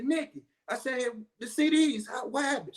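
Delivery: high, puzzled calling to his wife; bewildered rush to ask what happened; imitating his own startled self; retold confusion. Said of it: "I say".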